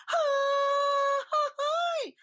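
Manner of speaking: high, loud mariachi yelp; piercing festive yell; grito cry. Retold or imitated. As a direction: let out a joyful Mexican grito. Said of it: "and he goes".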